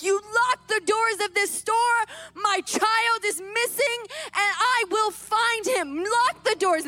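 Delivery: high, emphatic orders, hammered out; frantic command. Retold or imitated. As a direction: reenact a desperate mother demanding action. Frantic mother orders store locked.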